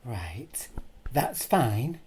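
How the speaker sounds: high, loud, drawn-out sing-song politeness; passive-aggressive. Retold or imitated. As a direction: imitate her over-sweet request, stretching the words. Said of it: "it was quite passive aggressive how she was".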